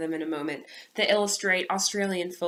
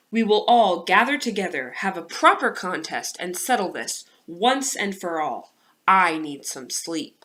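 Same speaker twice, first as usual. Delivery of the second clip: loud, weary elder announcing; tired Old Man; 6 dB louder than usual. Acted. Old Man announcing a contest to the animals, loud and weary. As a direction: call the creatures together, worn out.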